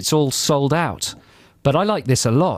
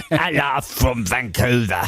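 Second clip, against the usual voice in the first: mock Vancouver accent; slower than usual. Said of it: "How do you do a Vancouver accent?".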